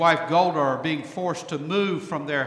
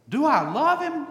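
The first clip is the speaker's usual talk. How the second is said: much higher, incredulous wife; astonished Golda; 10 semitones higher, 5 dB louder than usual. Retold or imitated. Preacher voicing Golda musing over her husband. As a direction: throw the question back in disbelief. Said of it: "Golda sighs".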